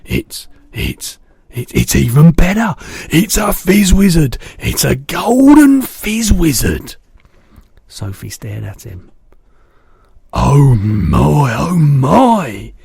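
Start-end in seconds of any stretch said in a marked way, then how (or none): 0.0-7.0 high, loud, slow delight; thrilled. A narrator's reading. BFG discovering a golden dream, high thrilled delight. 10.3-12.7 loud awed delight. A narrator's reading. BFG admiring the jar, loud delight.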